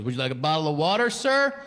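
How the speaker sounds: fast, overly polite driver; rising sir at the end; polite chauffeur voice. Retold or imitated.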